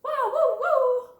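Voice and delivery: high, loud "wah, woo, woo"; vocal horn imitation; mock horn noise.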